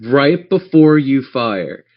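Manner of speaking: usual speaking voice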